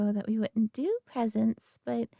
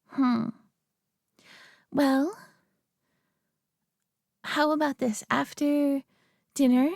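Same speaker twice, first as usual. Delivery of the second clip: normal talking voice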